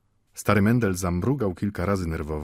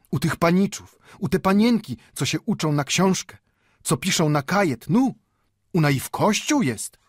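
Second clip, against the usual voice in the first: put-on high, fervent old man; increasingly impassioned; 10 semitones higher, 4 dB louder than usual. A narrator's reading. Old Mendel tells where his payment lives, impassioned. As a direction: warm to your own argument, pitched up throughout. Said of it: "Żyd sam się roznamiętniał swą mową".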